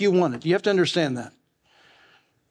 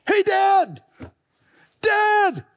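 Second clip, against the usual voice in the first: put-on child calling out; 13 semitones higher, much slower than usual. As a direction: yell up like a small boy wanting dad. Child calling to dad on tower.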